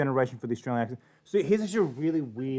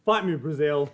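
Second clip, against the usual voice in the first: loud, raised taunt with a grunt; mock challenge; 3 semitones higher, 6 dB louder, slower than usual. Streamer taunting Brazil over the language, mock challenge. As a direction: playfully call out a fight.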